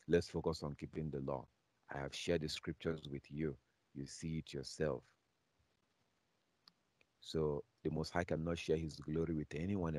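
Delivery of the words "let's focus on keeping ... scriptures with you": ordinary talk, nothing marked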